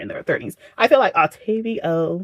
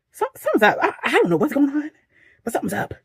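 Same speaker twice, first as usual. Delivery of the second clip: alarmed; 9 semitones higher, faster than usual. Retold or imitated. Reacting to strange license plates.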